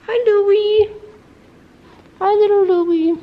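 pet talk. Real, not acted. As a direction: coo at a tiny kitten. Greeting the kitten.

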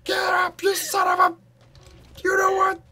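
high, loud furious cry; outraged screaming. Real, not acted. Dying in game, outraged.

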